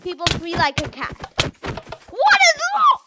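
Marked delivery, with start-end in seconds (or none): 2.1-3.0 much higher, loud startled question; alarmed squeak. Real, not acted. Player alarmed at the game, squealing a high question.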